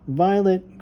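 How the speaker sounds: calling out from another room. Acted. Babysitter calling the girl to the kitchen, calling out. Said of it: "called the babysitter".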